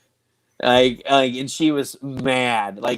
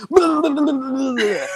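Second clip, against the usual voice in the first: high, loud babbling yell; loud nonsense burble, pitched way up; son's startle noise; 6 dB louder than usual. Retold or imitated. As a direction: imitate a kid jumping out to scare mom. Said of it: "he jumps out of the bathroom. He's like".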